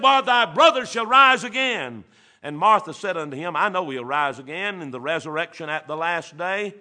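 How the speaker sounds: high, loud preacher's cadence; ringing revival preaching; preaching at full force. Acted. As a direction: carry the story at full volume.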